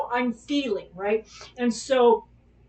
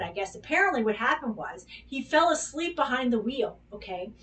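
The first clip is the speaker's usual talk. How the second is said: ordinary delivery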